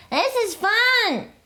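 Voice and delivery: high, slow little sister voice; kitten sister. A narrator's reading. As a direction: drawl it sweetly like a small kitten. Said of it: "said Jezebel".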